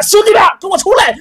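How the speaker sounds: loud, very high fast yell; calling out. Acted. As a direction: summon the bouncers at full voice. Manager calls his men.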